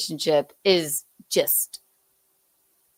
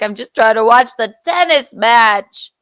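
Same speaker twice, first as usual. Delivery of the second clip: louder, lightly put-on protest; mock exasperation; 3 semitones higher, 7 dB louder than usual. Host imitating the man at the match, mildly exasperated protest. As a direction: protest loudly as the pestered tennis fan.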